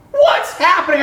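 high, loud, panicked question; mock alarmed shout; alarmed cry. Professor shaking during prayer, alarmed cry. Acts trembling woman's alarm.

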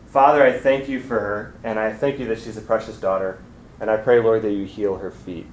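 usual speaking voice

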